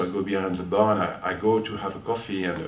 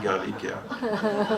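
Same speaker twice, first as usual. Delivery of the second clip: drawn out, much higher, through laughter; laughing name reveal; 12 semitones higher, much slower than usual. Real, not acted. Revealing the dog's name to the audience, slow laughing. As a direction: savour the name slowly with a laugh.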